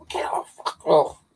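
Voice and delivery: slow, hushed imitation of yelling; mock call-out. Calling the neighbour's terrier off his ankles, mock hushed yell. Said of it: "you have to call out".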